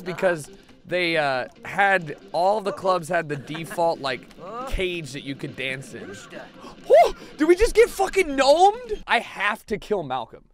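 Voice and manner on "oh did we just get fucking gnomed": high, loud shocked yelp; startled high outburst. Real, not acted. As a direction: jolt up in sudden alarm. Sudden game shock.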